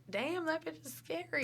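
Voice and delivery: soft, high-pitched aside with a laugh; hushed awed reaction. Retold or imitated. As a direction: say it under your breath, half laughing. Voicing her private reaction to fighting couple.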